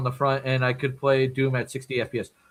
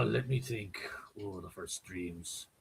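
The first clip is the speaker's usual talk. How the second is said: hushed musing; 8 semitones lower, 15 dB quieter, slower than usual. Pretend. Pretending to recall long ago.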